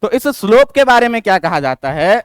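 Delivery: ordinary talk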